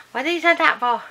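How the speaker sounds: high, loud mock protest; mum's grumble. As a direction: imitate Mum's fond complaint. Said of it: "she'll be like".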